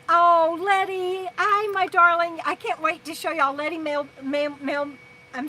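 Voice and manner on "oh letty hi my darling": high, slow, loud, drawn-out hello; fond greeting. Real, not acted. Welcoming Letty to the live chat, fond and bright. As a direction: greet a dear friend with delight.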